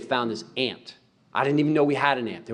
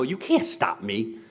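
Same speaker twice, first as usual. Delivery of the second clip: trash talk; 3 semitones lower, much slower than usual. Retold or imitated. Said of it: "She'd make a shot and go".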